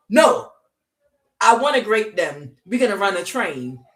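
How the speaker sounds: loud, high leering mob voice; eager leering shout; lustful mob voice. Acted. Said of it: "the reprobate mind was like".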